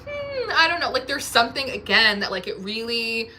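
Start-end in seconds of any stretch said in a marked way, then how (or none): 0.1-0.9 high, coy hmm of doubt; mock doubtful. Reader plays skeptic to king, high coy hmm. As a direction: feign polite doubt up high. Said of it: "giving benefit of the doubt".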